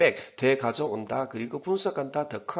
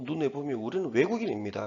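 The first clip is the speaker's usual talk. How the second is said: ordinary talk, nothing marked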